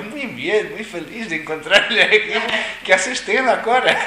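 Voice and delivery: high, loud, falsely cheerful; pretending to be glad, laughing; fake cheer. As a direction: overdo the delighted greeting. Fake-cheerful reply to the lama.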